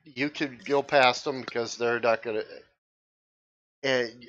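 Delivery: ordinary delivery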